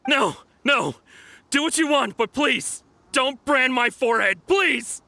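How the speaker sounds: high, loud frightened begging; shouted terrified plea; desperate pleading. Acted. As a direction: plead for mercy in panic. Thief begging not to be branded.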